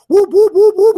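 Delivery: loud, very high imitated whoops; robot whooping noise. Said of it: "like, a thing on his hand".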